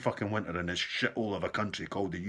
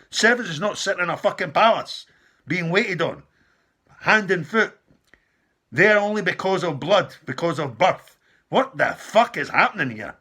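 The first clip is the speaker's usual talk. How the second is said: higher and louder, real rage boiling over; furious shout; 7 semitones higher, 8 dB louder than usual. Real, not acted. Ranting about royals waited on hand and foot, furious shout. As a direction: shout it at the screen, fed up. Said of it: "I just get so fucking angry".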